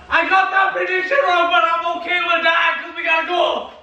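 high-pitched jubilant shout; excited shouting. Real, not acted. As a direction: shout it over your own celebration. Still celebrating the goal.

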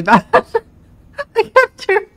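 loud, high burst of laughter; laughing. Real, not acted. Streamer cracking up at own bad accent, laughing. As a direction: break up laughing while talking.

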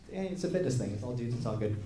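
low, soft mumble trailing down; uneasy self-reassurance. Retold or imitated. Talking himself into the all-dude fitness shoot, quiet uneasy self-reassurance. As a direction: reassure yourself under your breath, pitch sinking.

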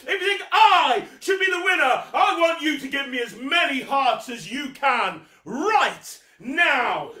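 booming high circus ringmaster; grand entrance announcement. Acted. Mock announcer introducing himself.

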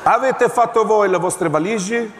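insistent officer. Acted.

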